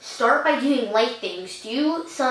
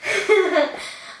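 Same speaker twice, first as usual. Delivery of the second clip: embarrassed laugh; 8 semitones higher than usual. Real, not acted. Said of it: "I'm a very emotional person".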